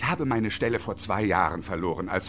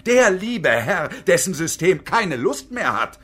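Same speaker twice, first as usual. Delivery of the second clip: raised, emphatic proclamation; grandiose declamation; 5 semitones higher, 5 dB louder than usual. Acted. Engineer lectures his partner on disorder, loud grandiose declaiming. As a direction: proclaim it with drawn-out words, building higher.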